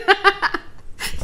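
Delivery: bright burst of laughter; high laugh. Real, not acted. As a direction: laugh at your own joke. Laughs at own joke.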